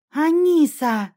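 протяжно и громче, радостно зовёт куклу; обрадованно выкрикнуть имя, растягивая; радостный крик. A narrator's reading. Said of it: "закричал довольный ребёнок". (девочка радостно кричит кукле, протяжно и громко)

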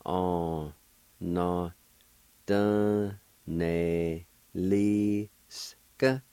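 on, na, dun, ne, li, s, k, each sounded separately; drawn-out teaching demo; syllable-by-syllable pronunciation. Shown as a demonstration. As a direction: stretch each syllable, pausing between. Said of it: "like this".

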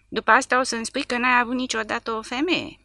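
taunting; mocking. A narrator's reading. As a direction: sneer at the prisoner. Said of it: "a zis micuțul batjocoritor".

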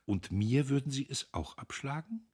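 smilingly insistent. A narrator's reading. Sister presses guest to accept, smiling and insistent. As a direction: press him sweetly. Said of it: "lächelte sie eindringlicher".

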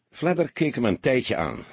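plain reading voice

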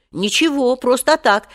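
high-pitched, hurried denial; hasty reply. A narrator's reading. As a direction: brush it off nervously and quickly. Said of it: "поспешно ответил Женя". (поспешно оправдываясь)

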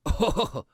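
soft, menacing villain chuckle; sneering chuckle. A narrator's reading. Cult leader scoffs at his enemy with a sneering chuckle. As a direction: give a short, softened scornful laugh. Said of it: "Ngũ Phương giáo chủ cười gằn nói".

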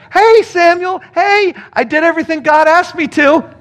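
mock Saul pleading. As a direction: ham up Saul's innocent protest. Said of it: "Saul is like".

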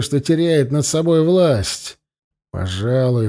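ordinary delivery

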